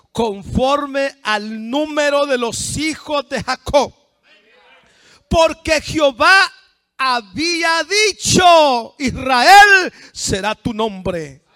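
high, loud, slow declamation; booming scripture delivery; full-force preaching. Acted. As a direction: proclaim each phrase with long stretched words.

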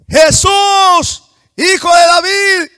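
very loud, high, sustained holler; desperate full-force shout. Acted. Blind man ignores the crowd and calls Jesus, full-force shout. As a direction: bellow each phrase, holding the last.

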